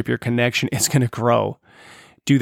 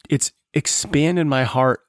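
ordinary delivery

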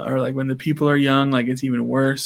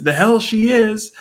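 high, slow mock outrage; outraged dad; 8 semitones higher, slower than usual. Dad rejecting daughter's date outfit, outraged. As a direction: imitate a father putting his foot down.

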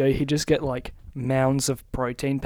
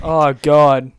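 clipping distorted mic; 9 dB louder than usual. Real, not acted. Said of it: "Stop yelling into the mic".

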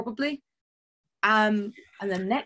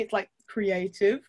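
ordinary talk

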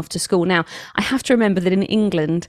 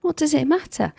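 light, higher voicing of the soothing phrase; calm reminder; 8 semitones higher than usual. Stressed mother hears the speaker's voice, lightly calm. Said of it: "I just heard your voice go".

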